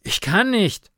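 high, loud, winded protest; breathless and strained; panting. A narrator's reading. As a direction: force it out short of breath. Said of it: "keuchte Karl".

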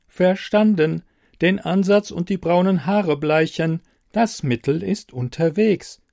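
a helpful machine, evenly spoken; computer voice. Acted. Computer voice in story.

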